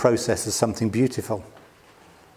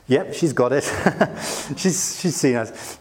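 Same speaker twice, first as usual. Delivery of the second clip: laughing. Real, not acted. Laughing at daughter's remark.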